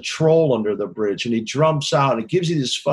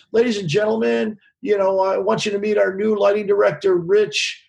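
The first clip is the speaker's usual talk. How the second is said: higher, mock stage announcer voice; stage announcement; 7 semitones higher than usual. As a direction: introduce him to the crowd like an emcee. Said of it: "Lindsay at the end of the show goes".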